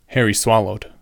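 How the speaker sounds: ordinary delivery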